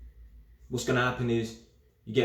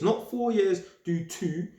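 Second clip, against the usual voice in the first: "Not four years" pitched high and punched; higher voice dismissing the idea, "do two" lifted; emphatic correction; 7 semitones higher, slower than usual. Real, not acted. Explaining IPP sentences to viewers, high emphatic correction. Contrasts the misunderstanding with the rule.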